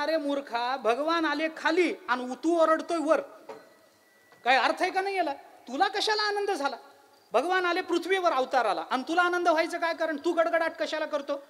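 high-pitched indignant questioning; acted scolding, pitched well up. Sky scolds the thundering cloud.

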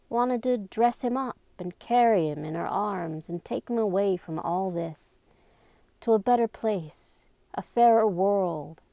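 usual speaking voice

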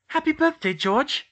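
high, loud happy birthday call; high and loud, delighted to see him; excited greeting. Acted. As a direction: burst in with a cheerful shout. Hermione greets her boyfriend.

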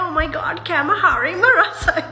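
high, loud mock fake accent on English and Sinhala. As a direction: overdo the put-on accent, stretching the words. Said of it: "accent fake කරන්න යන්න එපා". (බොරු accent එකකින් උස් හඬින් විහිළුවට අනුකරණය)

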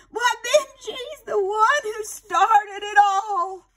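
put-on high, slow, fond old lady; fond aunt. Aunt Sarah crediting Benji, high slow and fond. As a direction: give the dog all the credit.

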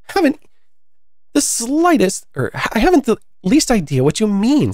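put-on high-pitched storybook girl, baffled; high, emphatic puzzlement; Alice, puzzled. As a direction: protest in a high child character voice.

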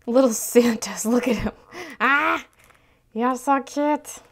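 loud cooing at a cute thing; excited anime fan. Real, not acted. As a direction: squeal like a fan over tiny Santas. Gushing over Santa stickers.

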